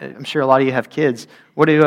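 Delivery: ordinary delivery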